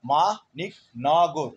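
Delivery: Maniknagar stretched out very slowly, beat by beat. Shown as a demonstration. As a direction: drag Maniknagar out so each syllable counts. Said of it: "আমার বাকযন্ত্রটা". (মানিকনগর খুব ধীরে টেনে বলা)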